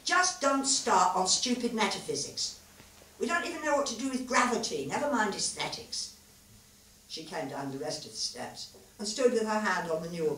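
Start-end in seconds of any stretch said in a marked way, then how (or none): 0.0-6.1 sounding angry. A narrator's reading. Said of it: "angry, but she knew he wasn't".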